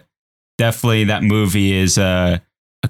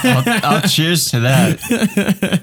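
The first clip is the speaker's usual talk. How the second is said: laughing; 9 semitones higher, slower than usual. Real, not acted. Laughing toast.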